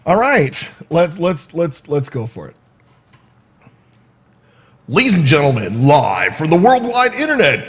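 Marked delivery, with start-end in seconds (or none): 4.9-7.7 big, loud ring-announcer intro; show announcer. Real, not acted. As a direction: shout it out, stretching the final words long. Host announces his show opening.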